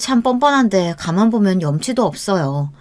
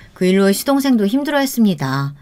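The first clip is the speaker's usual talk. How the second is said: plain reading voice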